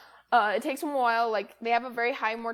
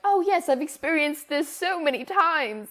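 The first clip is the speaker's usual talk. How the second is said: mock-posh British chicken, pitched higher and slowed at the end; British accent; 5 semitones higher, 3 dB louder than usual. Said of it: "Why are chickens British?".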